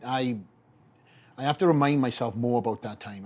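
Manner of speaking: ordinary talk, nothing marked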